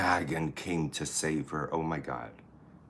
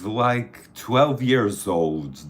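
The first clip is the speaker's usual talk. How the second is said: louder, slow tease about her age; teasing exclamation; 3 semitones higher, 8 dB louder, much slower than usual. Real, not acted. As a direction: playfully protest her age.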